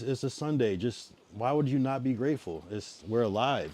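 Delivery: usual speaking voice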